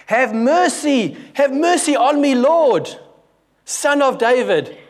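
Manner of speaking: high, loud cry for mercy, stretched slow; loud shriek. Shown as a demonstration. Preacher acts out the woman begging Jesus, loud shrieking cry. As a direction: shriek it out as a desperate plea. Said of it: "It's more like this".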